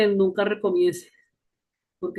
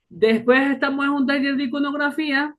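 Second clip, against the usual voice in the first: usual speaking voice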